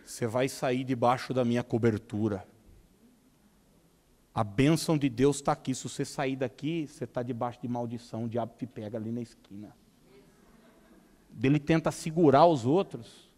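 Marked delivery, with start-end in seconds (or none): 0.0-9.7 1 domineering pastor. Retold or imitated. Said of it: "Daí ele fala assim, ó".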